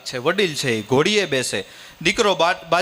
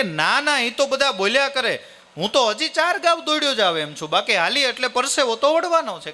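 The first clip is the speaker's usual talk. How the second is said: son's voice pitched much higher, eager; young son protesting; 10 semitones higher than usual. Acted. Son tells his father he can still run, pitched-up youthful protest. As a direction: brush it off like a cheerful young lad. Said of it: "કે".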